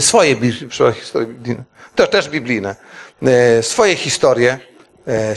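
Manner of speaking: ordinary talk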